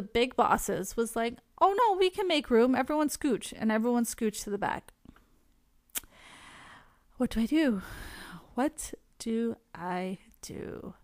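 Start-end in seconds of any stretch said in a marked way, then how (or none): 1.6-3.5 higher, breezy insistence, imitating a boss; lightly voiced boss waving someone in; imitated big boss. Imitates big boss making room. 8.5-10.9 slow, softer, word-by-word question; slow dilemma. Retold or imitated. Repeats dilemma slowly, word by word.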